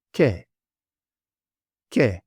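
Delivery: "ke" short, with k and open e; word demonstration. Shown as a demonstration. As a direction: say "ke" crisply on its own, pause between. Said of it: "So the sound of this word is".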